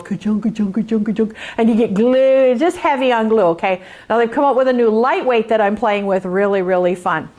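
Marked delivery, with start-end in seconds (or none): 0.0-1.3 low, mechanical ka-chunk imitation; needle thud noise. Showing viewers the wrong fusible, mimicking needle thuds. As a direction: mimic a needle jamming in glue. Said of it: "It goes".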